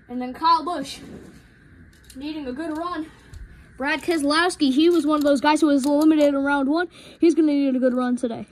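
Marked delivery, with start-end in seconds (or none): none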